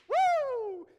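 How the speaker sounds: high, loud mock whoop. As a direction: whoop like a kid let loose. Mock celebration of sinning.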